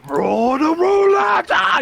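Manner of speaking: drawn-out, high-pitched battle scream; imitated scream. Retelling a character hauling a road roller, screaming. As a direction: scream it loud and high, mimicking an anime villain. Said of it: "he just screams".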